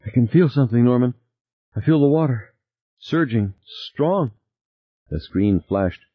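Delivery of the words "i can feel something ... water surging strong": panting. A narrator's reading. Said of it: "Beth was panting".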